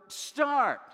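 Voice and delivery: sharp, high, loud command; urgent shout. Retold or imitated. Dad shouting at his teen driver, loud urgent command. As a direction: bark an order at a learner driver. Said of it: "I tried to explain to them when... imminent danger".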